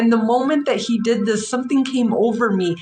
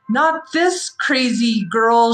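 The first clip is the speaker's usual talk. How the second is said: normal talking voice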